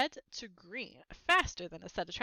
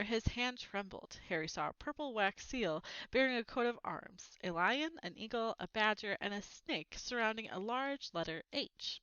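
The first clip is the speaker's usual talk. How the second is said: ordinary delivery, nothing marked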